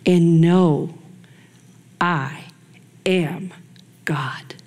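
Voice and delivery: from a firm "and know" down to a hushed "God"; fading reverent close. Acted. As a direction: let it die away word by word in awe. Slow fading scripture close.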